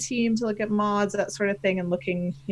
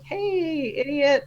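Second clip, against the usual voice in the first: higher-pitched "hey" drawn to get attention; mock calling out; 6 semitones higher, much slower than usual. Follower nudging him about a forgotten tag, mock calling out. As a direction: poke someone playfully. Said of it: "tag me again and be like".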